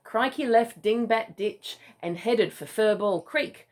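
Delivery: ordinary delivery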